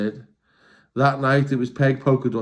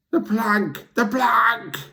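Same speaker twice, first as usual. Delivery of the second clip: higher chanted pirate yell; 9 semitones higher, much slower than usual. Acted. Pirates calling for the plank, mock yell. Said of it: "yelled Ben Buckle and Percy Patch".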